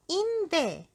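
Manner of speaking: high, loud little goblin. A narrator's reading. Goblin pointing at a hole in a tree, high voice. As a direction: pipe it out as a goblin. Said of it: "said the goblin".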